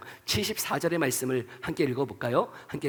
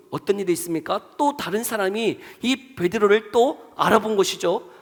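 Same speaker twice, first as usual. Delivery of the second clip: ordinary delivery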